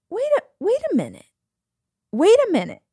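the penny suddenly drops; sudden realization. Retold or imitated. Sudden realization while driving home.

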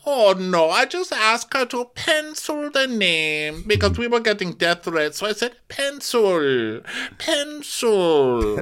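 high put-on accent voice, pencil drawn out slowly; lofty, slow pencil, pencil; mock Diana. Podcaster mocking Diana's pencil excuse, high and slow. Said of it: "And Diana's like".

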